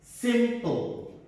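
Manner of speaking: "Simple" in two beats, high "Sim", falling "ple"; split into syllables. Shown as a demonstration. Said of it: "How many syllables?".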